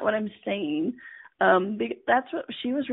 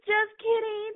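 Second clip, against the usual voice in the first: high forced laugh, just kidding; fake laugh; 11 semitones higher than usual. Trying to take back calling her a bitch, forced high laugh. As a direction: nervously laugh it off, high-pitched. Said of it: "I'm like".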